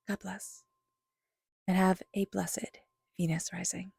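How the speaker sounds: slow, soft whispered farewell; whispered blessing. Acted. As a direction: let the blessing drift out on a whisper.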